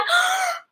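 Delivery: big audible gasp; sharp, demonstrated intake of breath; shocked gasp. Shown as a demonstration. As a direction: gasp in shock for others to copy. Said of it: "can you all gasp with me".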